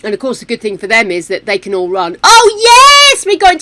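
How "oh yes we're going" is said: high, loud yelp of delight; delighted cry. Real, not acted. Gamer thrilled to reach Cupid's Corner, high delighted yelp. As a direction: squeal it out, thrilled.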